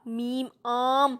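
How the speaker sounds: آم with the long آ drawn out slowly; stretched madd vowel. Shown as a demonstration. Teacher drawing out the madd vowel for pupils. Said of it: "مد کو ہمیشہ ذرا کھینچ کر لمبا کر کے پڑھتے ہیں".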